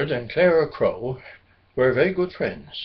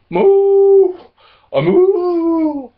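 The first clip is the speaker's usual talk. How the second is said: high, loud cow moo; loud bellow; 8 dB louder, much slower than usual. A narrator's reading. Cow showing her bird friend her hello, loud mooing bellow. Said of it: "a loud bellow".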